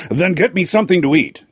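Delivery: high, demanding royal order; commanded. A narrator's reading. Hungry king to his steward, mildly commanding. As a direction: snap out the order for food. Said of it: "commanded the king".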